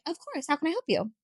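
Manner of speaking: high, sugary phone-rep politeness; customer service voice. Retold or imitated. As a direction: switch on the helpful call-center voice. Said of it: "Your customer service voice".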